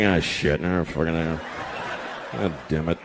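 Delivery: low, muttered grumbling; dejected low groan of a guy; miserable groan. Retold or imitated. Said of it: "The guys are like".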